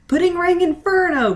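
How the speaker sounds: high, loud battle cry; yelled attack name. A narrator's reading. Pudding shouting her attack at the animals. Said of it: "Pudding yelled".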